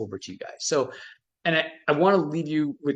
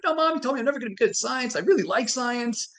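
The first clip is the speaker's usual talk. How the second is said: put-on higher, fast, hurt protest of a kid; upset kid; 9 semitones higher than usual. Telling mom about the science test, as an upset kid. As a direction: plead like a wounded seventh grader. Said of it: "I was all upset".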